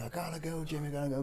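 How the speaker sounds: a hint of a frightened wife fleeing; panicked Karen. Karen telling Jimmy she must leave, mildly panicked. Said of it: "she's like".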